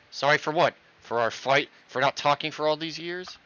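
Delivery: angry outburst. Acted. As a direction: throw each question back furiously. Angry outburst at sister's letter.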